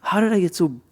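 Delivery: high, exasperated self-talk fading to a whisper; exasperated inner voice. Retold or imitated. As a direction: blurt it out, then trail off breathless. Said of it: "You're like".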